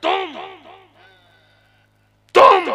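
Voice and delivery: sudden high, loud forced cry; words wrenched out in a jolt; bursting out. Acted. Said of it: "झटके से आवाज आई". (झटके से फूटती आवाज़)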